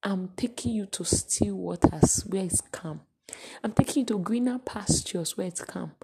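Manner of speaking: gentle whisper of the shepherd; soft reassuring shepherd. Acted. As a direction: soothe the frightened flock. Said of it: "And he said".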